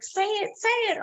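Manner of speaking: high-pitched mimicked urging; eager egging on. Bandmates egging her on.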